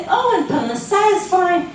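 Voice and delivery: proud little answer; chirpy cheerful reply. Retold or imitated. A man assuring the rabbi his living is fine, chirpy.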